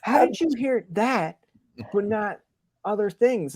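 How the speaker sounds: high, slow, incredulous whine; mock outrage at selective hearing; exasperated disbelief. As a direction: act baffled and put upon. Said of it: "And I'd be like".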